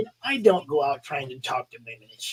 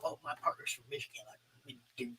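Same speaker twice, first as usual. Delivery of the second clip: hushed aside; 20 dB quieter, faster than usual. Retold or imitated. His own quiet aside in story.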